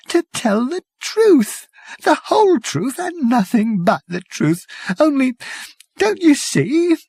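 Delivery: a terrified man reliving the trial; shivering dread. Acted. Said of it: "he paused and shivered".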